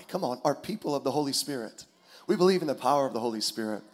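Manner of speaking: ordinary delivery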